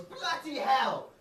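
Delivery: high, drawn-out exclamation; exasperated outburst. Acted. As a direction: cry it out slowly like a fed-up commuter. Acted outburst on the tube.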